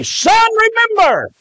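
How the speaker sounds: high preacher's cry; calling out. Acted. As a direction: call it out high, falling at the end. Preacher calls out scripture words.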